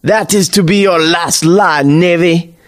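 put-on theatrical old seafarer's threat; scornful sneer. A narrator's reading. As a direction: sneer it like a vengeful villain. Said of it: "Jeff sneers a scornful laugh".

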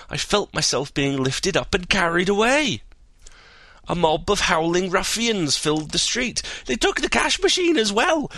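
high, breathless tall-tale telling; excited storyteller voice. Acted. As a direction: spin the wild yarn eagerly. Castor tells his tale.

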